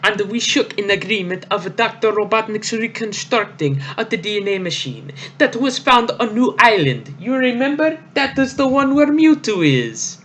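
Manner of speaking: high, hammy villain voice. Acted. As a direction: ham up a proud villain boss. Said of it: "it's Giovanni".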